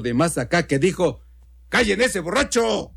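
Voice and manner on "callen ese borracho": higher-pitched call across the room; annoyed heckler calling out. Acted. Guest at the back about the drunk, annoyed call. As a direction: call out to get someone silenced.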